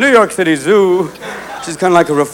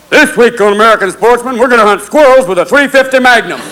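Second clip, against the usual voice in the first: higher-pitched hunting show host; host a hunt, eager and raised in pitch; gung-ho TV hunter; 6 semitones higher than usual. Acted. Plays TV sportsman hunter.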